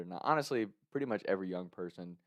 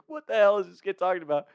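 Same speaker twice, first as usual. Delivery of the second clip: high, raised, incredulous; baffled scorn; 10 semitones higher, 6 dB louder than usual. Retold or imitated. The angry guys react to his excuse, loud and incredulous. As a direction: sneer at the nonsense he's saying. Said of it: "all looking at me like".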